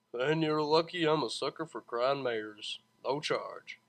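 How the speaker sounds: lightly voiced easygoing driver; a hint of a smooth cabbie; charming driver. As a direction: wave it off with charm.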